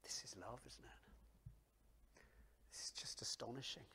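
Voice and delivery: soft, hushed wonder; awed whisper. Acted. Preacher marvelling at Jesus's love, whispered. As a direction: marvel under your breath.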